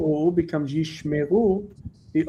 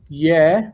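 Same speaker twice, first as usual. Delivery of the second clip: "ye" isolated, punched louder; secondary stress; 6 dB louder than usual. Shown as a demonstration. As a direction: lean firmly on "ye" as one syllable. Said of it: "secondary word stress".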